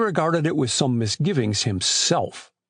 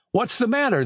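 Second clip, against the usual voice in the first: mildly raised, high-pitched call; shouted question; 10 semitones higher than usual. A narrator's reading. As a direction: call up with a touch of alarm, much higher. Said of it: "they shouted".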